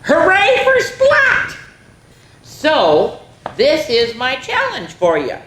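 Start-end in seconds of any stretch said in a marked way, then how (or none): 0.0-1.6 high, loud, slow cheer; loud high drawn-out hooray. Acted. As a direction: shout it out like the whole class cheering. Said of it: "and the class cheered".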